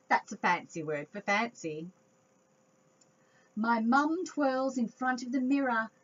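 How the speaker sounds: ordinary delivery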